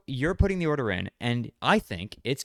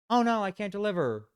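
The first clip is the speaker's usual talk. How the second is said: higher, put-on innocent oh no; mock fake regret; 8 semitones higher than usual. Mocking the worker's fake excuse, higher and put-on. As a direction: fake dismay, pretending he can't deliver. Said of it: "and then goes".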